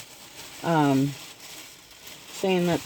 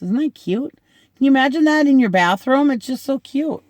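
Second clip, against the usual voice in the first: higher and louder, quick delighted gush; 8 semitones higher, 4 dB louder than usual. Real, not acted. Showing viewers the Christmas soap, gushing excitedly. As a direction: rave about it like a gift you adore.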